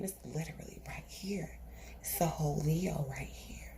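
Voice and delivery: hushed whisper, as if not to be overheard; very soft, voiceless aside; confiding whisper. Real, not acted. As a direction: whisper it secretively. Confiding about a lion watching her.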